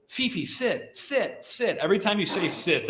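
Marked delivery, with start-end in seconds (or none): 0.1-1.8 higher, mock pleading owner repeating sit; mocking dog owner. Trainer mocks owners who nag their dogs, higher. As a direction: imitate a hopeless owner nagging the dog. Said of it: "you see these people that are like".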